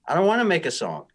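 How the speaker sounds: put-on high, fast whiny child; whiny kid voice. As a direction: whine it like a reluctant kid. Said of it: "exactly with that same whiny voice".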